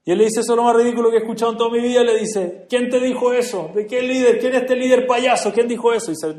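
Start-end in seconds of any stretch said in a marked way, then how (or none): 0.4-2.0 fast, loud and higher, scoffing; scornful outburst. Retold or imitated. Driver scoffs at the quote. 2.7-6.1 loud, high, rapid-fire questions; indignant demands. Retold or imitated. Driver demanding who said it.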